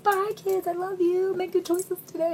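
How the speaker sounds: high, sing-song drop-off farewell; cheery aunt. Retold or imitated. Aunt dropping kids at school, high and cheery. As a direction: chirp it like an embarrassing aunt. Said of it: "and be like".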